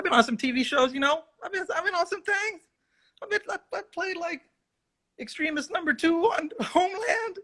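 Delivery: high, self-mocking brag; mock bragging. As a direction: puff up your small roles with a laugh. Bragging about TV roles.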